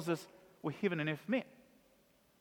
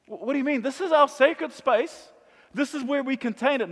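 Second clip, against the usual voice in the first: indignant crowd; 6 semitones higher than usual. Acted. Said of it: "voicing the temple crowd".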